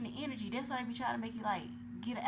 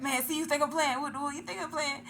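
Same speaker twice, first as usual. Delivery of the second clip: louder, higher mock indignation; imitated angry ex; 5 semitones higher, 7 dB louder than usual. Ex-husband insisting on the phone, mock indignant shouting. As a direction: play the offended ex shouting. Retelling ex's angry reply.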